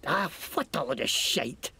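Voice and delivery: lightly Scottish mocking thug; Scottish accent. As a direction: sneer at them in Scots. Scottish NPC bargains.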